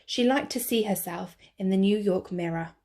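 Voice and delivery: plain reading voice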